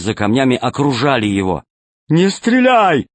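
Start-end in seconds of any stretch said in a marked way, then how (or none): 2.1-3.1 high, slow pirate yell; shouting. Acted. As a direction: bark the order to hold fire. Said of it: "крикнул Толстяк".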